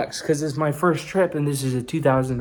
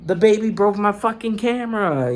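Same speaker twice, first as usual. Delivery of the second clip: upset outburst; 8 semitones higher than usual. Retold or imitated. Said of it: "I'm like".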